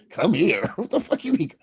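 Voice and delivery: very high, mock-outraged squawk; indignant comeback, nearly laughing; incredulous retort. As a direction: pitch shoots up in comic disbelief. Acted comeback to a stranger's call.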